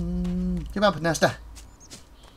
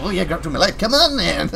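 mock-excited quote, louder and higher; 4 semitones higher, 7 dB louder than usual. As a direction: put on her excitement, playfully loud. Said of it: "She's very excited once she's like".

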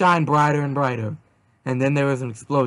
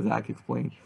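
ordinary delivery

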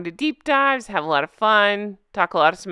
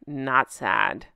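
deadpan; 11 semitones lower, 4 dB quieter than usual. Pretend. Punchline after true story.

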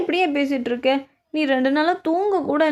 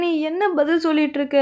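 ordinary talk

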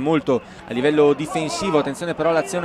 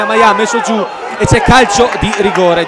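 excited commentary; 4 semitones higher, 6 dB louder than usual. Real, not acted. Commentator calls a penalty.

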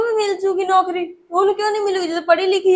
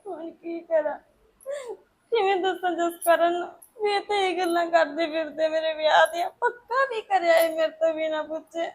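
much softer, weeping; tearful monologue; 7 dB quieter than usual. Acted. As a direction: cry quietly alone.

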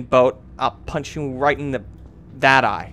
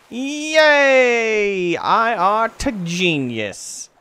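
loud, high drawn-out cheer; triumphant; 8 semitones higher, 5 dB louder, much slower than usual. Acted. Gamer congratulating himself as a genius, triumphant. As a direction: crow it slowly, high and stretched. Mock celebration of own cleverness.

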